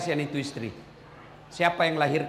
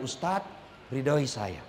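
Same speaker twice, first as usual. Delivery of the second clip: tender plea; 7 semitones lower, 7 dB quieter, much slower than usual. Retold or imitated. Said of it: "pasti dia bilang".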